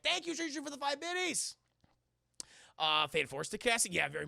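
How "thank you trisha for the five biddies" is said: high-pitched sing-out thanks; high thank-you call. Real, not acted. Streamer thanking Trisha for biddies, high-pitched sing-out. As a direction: thank them in a high, drawn-up voice. Thanking a viewer mid-story.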